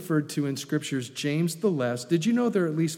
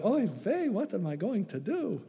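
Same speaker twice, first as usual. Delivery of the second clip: put-on Yiddish accent; 4 semitones higher, 6 dB quieter than usual. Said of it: "the mama would go around going".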